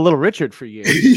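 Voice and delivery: chuckling through the words; laughing. Real, not acted. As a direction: laugh while you say it. Laughing tease about voice.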